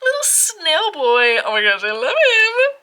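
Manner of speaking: high, loud cooing over the card; delighted squeal. Real, not acted. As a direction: gush over a cute creature.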